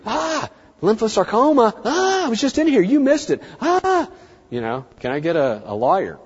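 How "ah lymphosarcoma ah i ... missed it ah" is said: high, whiny complaining client; upset client. Retold or imitated. Client blaming the vet for a missed tumour, high and whiny. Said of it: "rather than them waiting three months coming in here".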